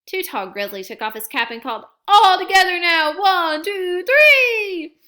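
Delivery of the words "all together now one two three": high, loud cheering countdown, peaking on three; rally everyone, shouting the count; yelled countdown. A narrator's reading. Too Tall leading the cubs' cap toss, shouted high. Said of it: "He yelled".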